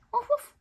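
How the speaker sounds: high, loud woof woof; high dog bark. Acted. Dog barks again.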